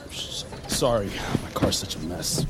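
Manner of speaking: normal talking voice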